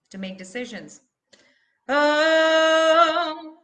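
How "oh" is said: high, very loud held oh; growing angry oh. Shown as a demonstration. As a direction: let the anger grow on the vowel. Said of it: "it's growing".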